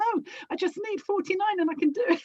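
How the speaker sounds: high, fast, insistent; mock stubborn old lady. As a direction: brush off the fire alarm to finish the puzzle. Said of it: "They'd be like".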